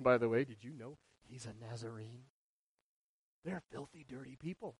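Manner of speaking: hushed contempt; conspiratorial whisper. Retold or imitated. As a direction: lean in and sneer it quietly. Voicing contemptuous gossip.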